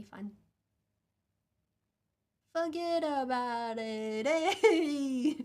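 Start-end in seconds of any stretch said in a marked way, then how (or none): 2.5-5.3 mock Italian American. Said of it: "we're all Italian today".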